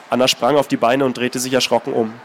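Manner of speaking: calm, measured reading of a novel; story narration. A narrator's reading. Author reading his novel aloud, steady narration. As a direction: read the passage steadily, letting it speak.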